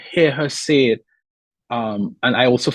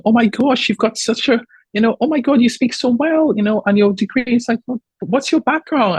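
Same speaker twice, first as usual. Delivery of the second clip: much higher, mock gushing voice; gushing interviewer; 10 semitones higher than usual. Interviewer praising her speech, high mock gush. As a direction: overdo the patronising praise.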